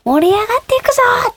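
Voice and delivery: high, bright rallying call to a crowd; concert hype shout. Retold or imitated. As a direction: rouse tens of thousands of fans from the stage. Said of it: "何万人もの方に".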